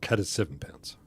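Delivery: hushed whisper, almost unvoiced. Real, not acted. Reading a chat comment to viewers in a whisper. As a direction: breathe it out as an aside.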